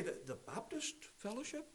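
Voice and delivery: soft, baffled echo of the question; a quiet, bewildered old brother who never heard of it; mock puzzled elder, hushed. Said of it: "and he would say".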